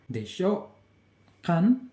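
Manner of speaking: "Desho" and "Kan" each as a short rising question; nada naik. Shown as a demonstration. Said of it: "jadi nadanya itu naik". ("Desho" dan "Kan" bernada naik)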